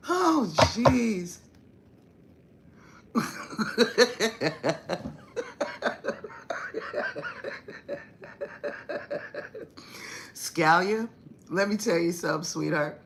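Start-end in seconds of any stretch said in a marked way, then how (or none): 3.1-8.0 shrill breathless laugh; high squealing laugh. Real, not acted. Cracking up at the story.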